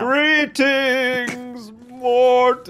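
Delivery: high, drawn-out villain greeting; hammy devil voice; dark lord. Pretend. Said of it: "bad at R Ping the devil".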